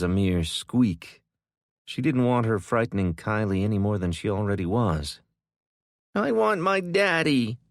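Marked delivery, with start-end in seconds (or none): 6.1-7.6 put-on crying child. A narrator's reading. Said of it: "Kylie cried".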